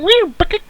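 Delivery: very high squeal; cartoon squeak effect; silly squeaky noise. Imitating gunshot scene sounds.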